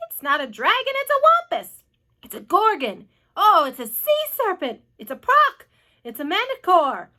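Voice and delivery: loud overlapping-style alarm cries, jumping in pitch; excited, loud exclamations; crowd shouting. A narrator's reading. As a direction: shout rival guesses as different panicked onlookers. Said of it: "all started shouting at once".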